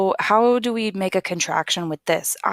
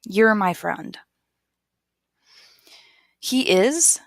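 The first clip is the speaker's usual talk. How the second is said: ordinary delivery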